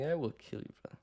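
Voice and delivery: quiet menacing inner voice; inner psycho thought. Pretend. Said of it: "laugh internally and think".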